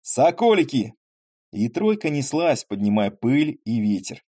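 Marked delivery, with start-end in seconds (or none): 0.0-0.9 calling to horses. Acted. Urging horses on.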